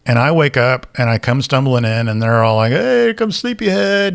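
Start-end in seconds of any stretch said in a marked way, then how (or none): 2.7-4.1 teasing roommates. Retold or imitated. Roommates teasing him at breakfast.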